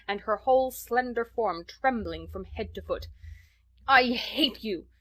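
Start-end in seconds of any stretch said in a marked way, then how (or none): 3.9-4.9 slow, higher, louder choked cry of hate. A narrator's reading. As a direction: force it out through anger. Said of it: "she cried in a choked voice".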